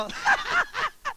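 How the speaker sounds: high, loud burst of laughter; mocking laugh. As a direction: laugh loudly at the bill. Acted laugh at the vendor's bill.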